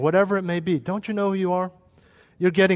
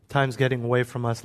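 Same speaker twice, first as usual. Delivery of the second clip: normal talking voice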